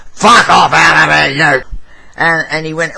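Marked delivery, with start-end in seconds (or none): 0.1-1.6 scare him off with a hard man's voice; butch voice. Retold or imitated. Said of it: "in a real butch voice".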